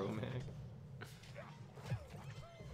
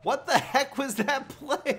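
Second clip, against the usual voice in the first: shocked outburst; 13 semitones higher, 10 dB louder than usual. Real, not acted. Shocked at opponent's play.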